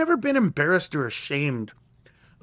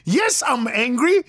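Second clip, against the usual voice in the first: high-pitched comic outrage; worked-up, incredulous mock rant; retold anger; 11 semitones higher, 3 dB louder than usual. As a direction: act out indignant fury, climbing higher to the end. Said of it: "Are you angry?".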